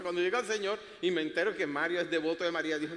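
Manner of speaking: ordinary talk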